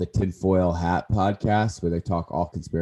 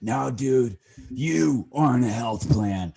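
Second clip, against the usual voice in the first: higher, louder, slow insistent tough-guy voice; Andy Frisella impression; 6 semitones higher, 3 dB louder, slower than usual. Imagining Andy scolding him, insistent Frisella impression. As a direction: correct him like a blunt coach. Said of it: "if Andy Frisella heard me say this".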